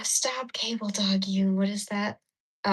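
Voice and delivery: usual speaking voice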